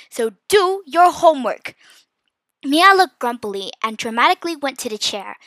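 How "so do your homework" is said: loud, firm mom order; angry shout. A narrator's reading. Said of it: "Mom shouted angrily".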